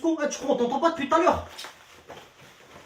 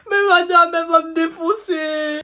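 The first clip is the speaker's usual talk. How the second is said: peur et sanglots; 5 semitones higher, 6 dB louder than usual. Acted. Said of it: "tu as l'air d'avoir peur".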